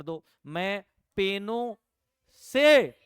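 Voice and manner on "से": से shouted out, much louder and higher; teacher's emphatic से; punched से. Shown as a demonstration. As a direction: hit से hard and high like a teacher driving it home. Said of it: "से तो से ही है साहब।".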